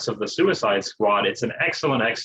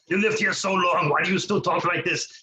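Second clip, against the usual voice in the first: Austrian impression; 5 semitones higher than usual. Arnold voice questioning mom.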